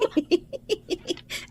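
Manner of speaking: breathy scornful laugh; mocking laugh. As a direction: laugh her off with disdain. Madam mocks the maid.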